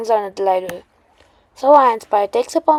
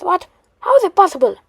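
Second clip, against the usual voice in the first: higher, louder startled villain; shocked disbelief; 8 semitones higher, 4 dB louder, faster than usual. Acted. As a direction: blurt it out in disbelief. Shocker shocked by failed blast.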